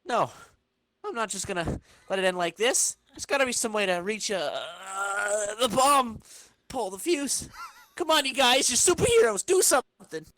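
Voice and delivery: high, loud desperate cries for help; panicked pleading. Acted. Flash begging the superheroes to stop the bomb, panicked and loud. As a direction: shout up at the heroes in panic.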